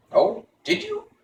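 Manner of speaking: high, hollow-headed wooden man; Pumpkinhead voice. Acted. Jack Pumpkinhead asks Tip where they go, high voice. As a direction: pitch it way up like a carved pumpkin man.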